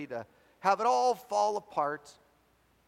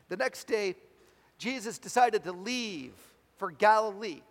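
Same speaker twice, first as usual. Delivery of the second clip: raised, projected storytelling voice; dramatic scripture reading; 5 semitones higher, 6 dB louder than usual. Acted. Preacher reading John chapter one to the congregation, dramatic scripture reading. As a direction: read the gospel out with acted quotes. Reads the gospel story aloud.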